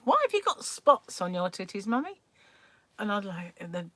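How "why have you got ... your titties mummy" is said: mock shout of a small boy, rising high at the end; child shouting out. Small son to mother in changing room, mock shouting out. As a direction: imitate a child calling out a rude question. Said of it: "he would shout things out like".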